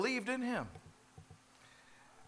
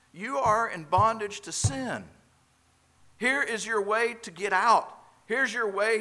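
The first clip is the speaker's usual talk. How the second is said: urgent preaching; 6 semitones higher, 6 dB louder than usual. Real, not acted. Preacher urges listeners.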